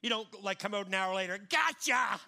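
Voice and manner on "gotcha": playful gotcha. Retold or imitated. Parent popping out in hide-and-seek.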